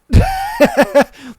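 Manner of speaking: loud, high-pitched laughing burst. Real, not acted. Laughing after telling people to chill out, high laugh.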